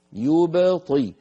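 "يبطي" ببطء شديد وباء مقلقلة بين ضمة وكسرة; أبرز الباء دون تأثر بالحركات; قلقلة الباء. Shown as a demonstration. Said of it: "قبله ضمة يو وبعده كسرة طي". ("يبطي" بباء ساكنة صافية)

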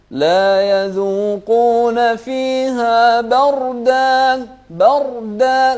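loud high slow chant, heavy R in بردا. Shown as a demonstration. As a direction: fill the R of بردا. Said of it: "الراء هني مفخمة". (تفخيم الراء في بردا)

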